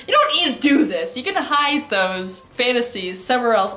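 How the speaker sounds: normal talking voice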